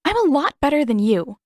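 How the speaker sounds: offended sister, high and loud; indignant retort. Acted. Katara retorting, glaring.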